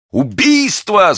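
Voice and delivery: very high, drawn-out yell; frantic scream. A narrator's reading. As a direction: cry murder in a wild voice. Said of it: "закричал Патрик не своим голосом".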